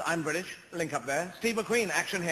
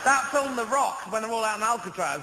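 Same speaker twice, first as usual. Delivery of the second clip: usual speaking voice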